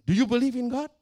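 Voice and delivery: loud, high demanding question; forceful challenge. Retold or imitated. Pastor to weeping atheist at the altar, loud challenge. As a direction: press him hard for an answer.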